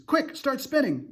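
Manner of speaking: high, loud command; urgent order. A narrator's reading. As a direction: bark the order in a hurry. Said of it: "cried, yelled James".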